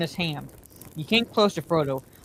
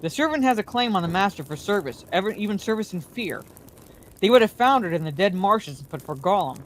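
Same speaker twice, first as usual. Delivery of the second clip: ordinary delivery, nothing marked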